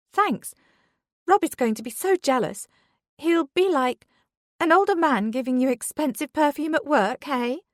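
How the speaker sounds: high, thrilled, gushing coworker; excited high voice. A narrator's reading. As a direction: bubble over with glee.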